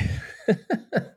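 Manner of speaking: high, brief real laugh; short laugh. Real, not acted. Veteran trailing off on the nation's failure, short laugh. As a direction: break off into a quick chuckle.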